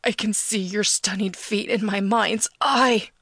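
slow, understated cry of agony; agonized yell. A narrator's reading. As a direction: strain it out slowly as if tormented by longing. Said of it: "suddenly sounds like he's in agony".

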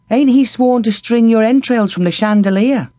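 put-on worried young lad asking; sickly boy Taster. A narrator's reading. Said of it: "asked Taster".

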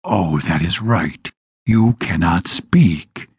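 low, slow demon voice; slow, low and menacing; demon taunt. A narrator's reading.

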